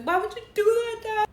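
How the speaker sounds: high, loud imitation of an upset daughter; mad daughter. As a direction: whine up high at dad, imitating a child. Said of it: "She was mad at her dad".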